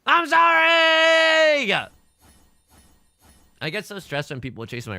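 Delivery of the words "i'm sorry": very high, loud drawn-out yell; yelled mock apology. A tile apologizing to the player, screamed in mock anguish. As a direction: scream the apology, stretching the last syllable. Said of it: "another tile that's like".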